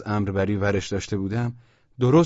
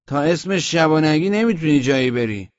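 louder, higher refusal; stubborn sentry; 5 semitones higher, 6 dB louder than usual. A narrator's reading. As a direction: block the captain's way with your voice. Sentry blocks the summoned captain. (امتناع نگهبان)

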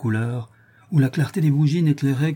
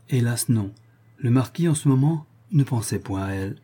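ordinary delivery, nothing marked

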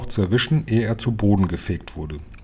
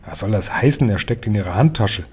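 usual speaking voice

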